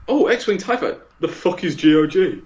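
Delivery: high, excited mock of a friend; imitating Jay. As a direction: squeak it out like an overeager mate. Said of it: "Jay was literally like".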